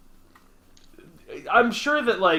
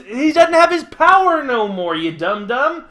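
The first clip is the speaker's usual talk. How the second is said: higher and louder, mock exasperated scolding; 8 semitones higher, 8 dB louder than usual. Real, not acted. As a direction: scold your friend like a playful dum-dum. Exasperated rant at cohost.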